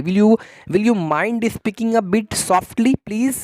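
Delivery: very slow, careful teacher read; slow model phrase. Shown as a demonstration. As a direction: repeat it slowly for students to copy. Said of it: "इस तरीके से आप उसको". (धीमा, साफ़ उच्चारण)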